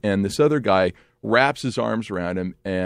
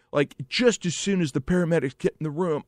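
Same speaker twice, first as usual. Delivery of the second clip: usual speaking voice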